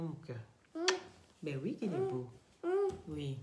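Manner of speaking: hushed, gentle voice turned away to calm a little one. Real, not acted. Mother quietly calming her child with the Lion King, hushed and tender.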